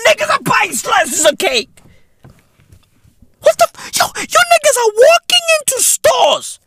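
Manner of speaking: high, shrieking disbelief; mock outrage. Comedian shocked that people buy cake slices. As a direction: squeal it in horror.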